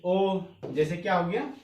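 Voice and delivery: ordinary delivery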